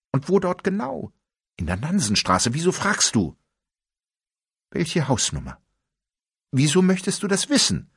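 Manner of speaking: a rapid, pressing interrogation; tense back-and-forth. Acted. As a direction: fire the questions, rising alarm.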